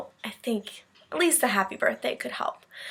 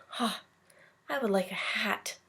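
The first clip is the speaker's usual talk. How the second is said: quiet reply; 10 dB quieter than usual. A narrator's reading. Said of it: "Then he replied".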